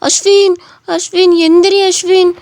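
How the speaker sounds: high, loud frantic cry of his name; panicked calling. Acted. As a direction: shout for him to get up. Calling out to fallen Ashwin.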